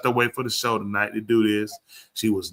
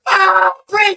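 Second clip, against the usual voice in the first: high, loud mock wail of agony; screaming; 8 dB louder, slower than usual. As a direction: imitate her screaming down the phone. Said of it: "She's screaming".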